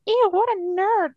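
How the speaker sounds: high, slow, disgusted sneer; mean girls mocking. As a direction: drawl "Ew, what a nerd" like a snobby teen girl. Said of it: "and they're like".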